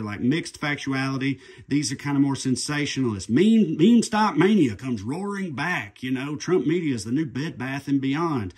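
walk them through the app like an ad; ad read. Acted.

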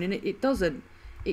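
normal talking voice

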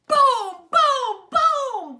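big booming stomps, high and loud; booming footsteps. A narrator's reading. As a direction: boom out each giant step. Giant bear stomping footsteps.

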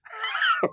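sudden high-pitched laugh; high squeal of laughter. Real, not acted. Coach laughing at his own book joke, a sudden high squeal of laughter. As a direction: let out a high burst of laughter.

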